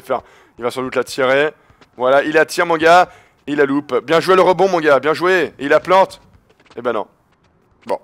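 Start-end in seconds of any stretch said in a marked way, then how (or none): none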